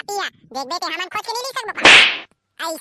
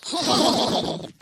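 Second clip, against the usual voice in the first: breathy laugh; 4 semitones lower than usual. Real, not acted. Laughs after the mock threat.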